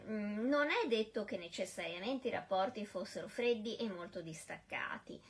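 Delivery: ordinary talk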